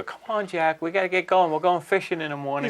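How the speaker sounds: high-pitched mock girlfriend coaxing; lightly hammed-up female voices; imitated girls. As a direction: pitch it up like girls urging him along. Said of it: "these two girls come up and go".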